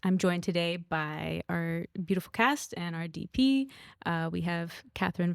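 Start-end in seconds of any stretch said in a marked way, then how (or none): none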